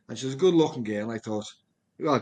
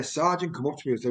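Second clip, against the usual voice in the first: usual speaking voice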